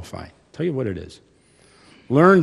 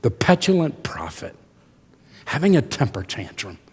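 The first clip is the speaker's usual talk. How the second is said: usual speaking voice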